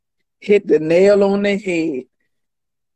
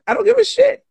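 much higher, defiant; 13 semitones higher than usual. Shown as a demonstration. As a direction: jump way up in pitch, defiant.

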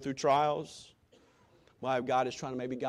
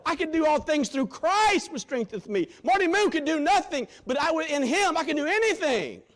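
fiery preacher's cry; full-force preaching; 13 semitones higher, 8 dB louder than usual. Real, not acted. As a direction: thunder it out to the congregation.

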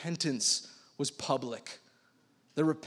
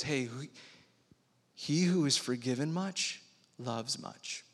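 gentle Jesus; 2 semitones lower, 5 dB quieter than usual. Retold or imitated. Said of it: "And Jesus says".

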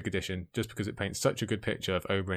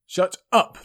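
a sudden howl, much higher and louder; 10 semitones higher, 11 dB louder than usual. A narrator's reading. The Mountain howling at the screaming stable boy, enraged howl. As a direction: bellow it like a maddened beast. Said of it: "the mountain howled at the stable boy's scream".

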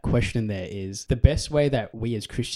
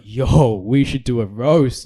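loud, drawn-out hype call; loud slow hype; 10 dB louder, much slower than usual. Retold or imitated. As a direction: shout it slow like a hype man. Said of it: "And I was like".

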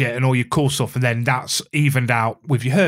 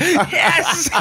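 laughing. Real, not acted. Celebrating being called awesome.

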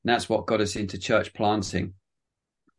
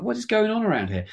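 much higher, puzzled exclamation; retold bewilderment; 11 semitones higher than usual. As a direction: recount your bafflement with pitch jumping up. Retold bewilderment at unseen presence.

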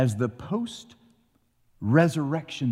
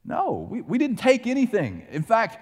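protesting brothers; 11 semitones higher than usual. Retold or imitated. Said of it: "the oldest say".

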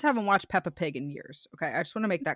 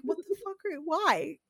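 baffled reaction; 9 semitones higher than usual. Retold or imitated. Retold baffled reaction to son.

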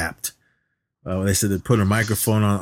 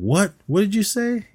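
higher-pitched, sharp retold outburst; mock angry caller; 12 semitones higher than usual. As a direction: snap it back like a creep caught off guard. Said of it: "he seemed to get super angry".

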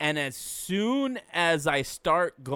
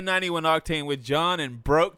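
announcer voice; 2 semitones higher, 7 dB louder than usual. Pretend. Mock podcast intro.

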